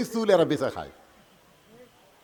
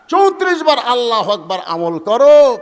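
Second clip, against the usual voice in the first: raised, forceful preacher's command; loud exhortation; 5 semitones higher, 12 dB louder than usual. Real, not acted. Preacher urging the listeners to practise remembrance, loud exhortation. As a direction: urge the congregation at full voice.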